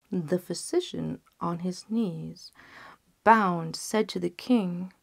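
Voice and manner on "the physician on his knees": usual speaking voice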